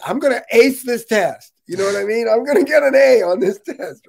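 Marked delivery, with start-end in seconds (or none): 0.1-1.5 higher and louder, pumped-up boast; eager confidence. Retold or imitated. Recalling his eagerness to ace step three, higher, louder and pumped. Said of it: "it was like". 2.3-4.1 higher and louder, pumped-up boast; eager confidence. Retold or imitated. Recalling his eagerness to ace step three, higher, louder and pumped. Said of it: "it was like".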